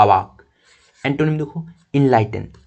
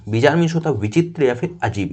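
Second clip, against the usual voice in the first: ordinary talk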